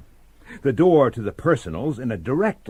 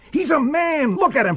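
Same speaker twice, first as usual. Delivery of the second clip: heated insistence; 14 semitones higher, 4 dB louder than usual. Acted. Bailey insisting in heated quarrel.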